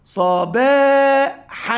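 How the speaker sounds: صباحا stretched slowly, ending without nunation; slow syllable reading. Shown as a demonstration. Teacher reading صباحا slowly for pupils. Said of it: "أنطق الكلمة نطقا عاديا".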